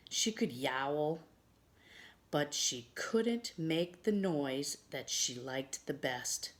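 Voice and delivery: ordinary delivery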